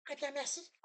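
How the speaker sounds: soft squeaky falsetto; high thin voice, pulled back. Shown as a demonstration. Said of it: "On va faire une voix sur aiguë".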